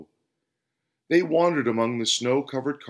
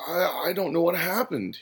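dazed, higher-pitched faltering mumble; hesitant and dazed; mumbling; 6 semitones higher than usual. A narrator's reading. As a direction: stammer it in confusion. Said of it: "he mumbled".

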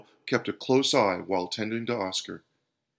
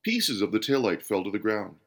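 usual speaking voice

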